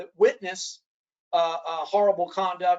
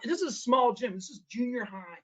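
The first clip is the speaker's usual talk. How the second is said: ordinary talk, nothing marked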